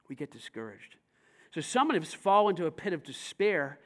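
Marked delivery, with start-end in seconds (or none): 0.1-1.0 a low murmured echo of the line; softer, lower repeat. Acted. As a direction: drop the voice right down on "We get discouraged". Repeats it, drawn out.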